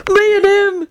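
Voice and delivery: very high, overcome gasp. A narrator's reading. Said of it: "Sirius gasped".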